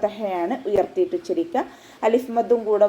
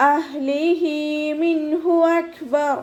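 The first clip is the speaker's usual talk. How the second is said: higher, slow chanted അഹ്ലിഹി with a breathy ഹ; correct recitation; 7 semitones higher, much slower than usual. Shown as a demonstration. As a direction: chant അഹ്ലിഹി from mid-throat. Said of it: "ശ്രദ്ധിച്ചിട്ട് പാരായണം ചെയ്യുക".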